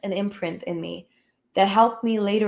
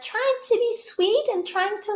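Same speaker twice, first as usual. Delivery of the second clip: much higher, put-on sweet tone; sugary, higher fake-nice voice; 12 semitones higher than usual. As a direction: imitate your own meek people-pleasing voice. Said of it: "talking in that".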